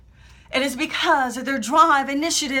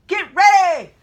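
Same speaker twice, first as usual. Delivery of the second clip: loud shouted reply; group echo shout; 5 semitones higher, 11 dB louder than usual. Retold or imitated. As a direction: shout it back like a trainee. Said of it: "Everyone had to repeat".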